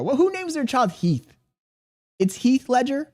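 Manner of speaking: comic high-pitched indignation; indignant disbelief. Real, not acted. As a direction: squeal in mock outrage at the name. Outrage over the name Heath.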